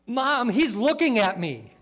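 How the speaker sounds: put-on high whiny kid tattling to mom; whiny tattling little sibling. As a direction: whine it like a little brother telling on you. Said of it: "he goes".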